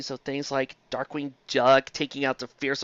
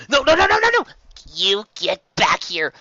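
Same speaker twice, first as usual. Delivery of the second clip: much higher, loud no-no-no; panicked protest; 5 semitones higher, 6 dB louder than usual. Real, not acted. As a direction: beg them to stop. Refusing the review, panicking.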